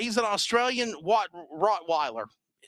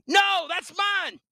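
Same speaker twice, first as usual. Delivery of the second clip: scolding the dog; 11 semitones higher, slower than usual. Real, not acted. He's trying to eat my damn Snickers bar.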